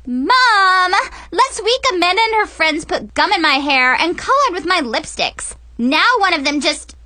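put-on high, loud complaining teen; bratty teen whining. Mackenzie tattles to Mom, high and loud.

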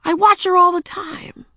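put-on high, loud girl insisting; a little girl protesting she's grown-up; insistent girl voice. A narrator's reading. Ramona insists, much higher.

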